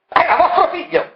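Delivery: high shrill cry of accusation; shouted. Acted. Marella accusing Variconi, high shouted outburst. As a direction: burst out at him. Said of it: "che aveva gridato".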